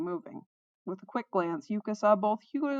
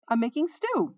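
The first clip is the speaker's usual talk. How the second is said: higher-pitched call from indoors; 7 semitones higher, 3 dB louder than usual. A narrator's reading. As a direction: call it out to the others. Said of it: "Tonda called out from inside".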